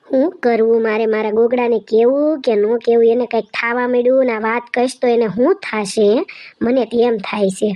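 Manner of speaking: low worried voice. Acted. A sister-in-law wondering whether to tell, low and worried. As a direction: brood over the secret in a low voice. One performer voicing another character.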